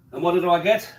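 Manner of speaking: ordinary talk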